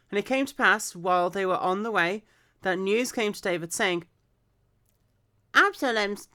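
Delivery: clear, projected story reading; scripture read-aloud. Acted. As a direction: read the Bible story out with expression.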